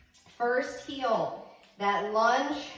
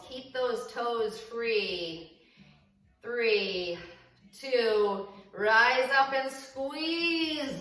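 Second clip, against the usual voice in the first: slow drawn-out cues; much slower than usual. Real, not acted. Coach cueing a slow squat.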